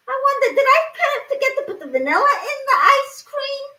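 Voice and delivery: high, fast, laughing through the words; laughing realization. Real, not acted. Cook realizing he forgot the vanilla, laughing high and fast. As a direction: blurt it out mid-laugh at yourself.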